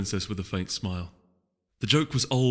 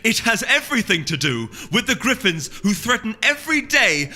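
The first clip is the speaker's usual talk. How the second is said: higher and louder, slowing on the last words; heated retort; 10 semitones higher, 5 dB louder than usual. Acted. Duchess angrily answering the duke at council. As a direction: push back hard across the council table. Celerity snaps back at duke.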